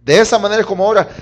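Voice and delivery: normal talking voice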